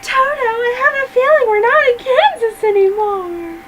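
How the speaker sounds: high, wide-eyed Dorothy voice; Dorothy quote. Retold or imitated. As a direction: quote it like a little girl lost. Quoting Wizard of Oz.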